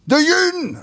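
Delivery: high, angry dialect shout; angry outcry. Acted. Furious uncle demanding the onion, angry dialect shout. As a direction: bellow it at the boy in dialect. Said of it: "riep hij kwaad uit".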